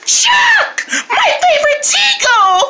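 very high squeal of delight; overjoyed greeting. Acted. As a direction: squeal at a favourite friend, hammed up.

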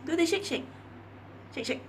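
high, loud coaxing command to a dog. Real, not acted.

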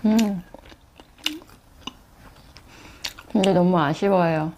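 speaking between bites; talking while chewing. Real, not acted. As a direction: mouth partly full of food. Seen 0: Holds bitten sandwich; cheeks slightly full, jaw working while talking.

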